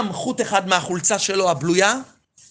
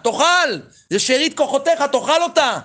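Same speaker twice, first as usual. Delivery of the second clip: higher, insistent urging; urgent coaxing; 7 semitones higher, 3 dB louder than usual. Pretend. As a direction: push him to eat, pitched up. Urging the old man to eat.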